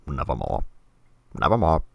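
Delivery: low, clipped parrot-like nevermore; imitating a parrot raven. Mocking Christopher Lee's raven, low and birdlike. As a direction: mimic a bird repeating speech. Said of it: "He was like".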